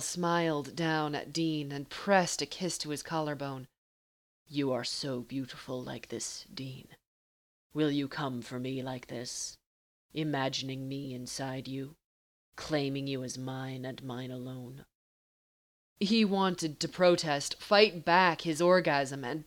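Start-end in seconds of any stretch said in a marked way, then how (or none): 4.5-14.8 low and unhurried, taunting a lover; teasing Castiel. Acted.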